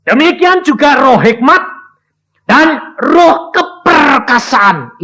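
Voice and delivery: high, loud, drawn-out preacher's cry; fiery preaching. Acted. Preacher crying out to the church about understanding. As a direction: preach it out to the congregation.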